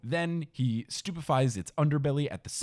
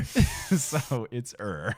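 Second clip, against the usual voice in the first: normal talking voice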